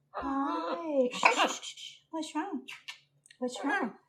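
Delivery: soft shushing, gentle concern; soothing pet talk. Real, not acted. Owner hushing her bird getting wild, soft soothing. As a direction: hush and comfort an agitated pet.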